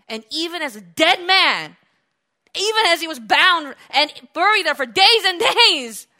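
loud, high, building delivery; impassioned pulpit voice; full-force preaching. Real, not acted. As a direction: drive each phrase home to the congregation.

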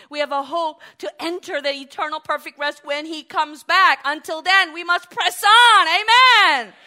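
building to a loud, high preaching climax; preaching at full force. Acted. Preacher rousing the congregation to press on, building to a shout.

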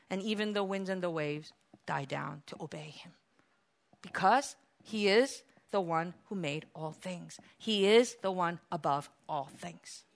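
low, soft and weighty; quiet, solemn awe; hushed reverence. Real, not acted. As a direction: let the storm die down in your voice. Preacher drops to hushed reverence.

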